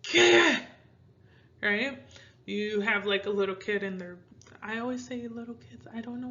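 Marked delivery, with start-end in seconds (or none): 0.0-0.6 high loud irritated qué; fed-up demand; frustrated qué. Shown as a demonstration. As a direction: throw it out annoyed. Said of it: "You can say".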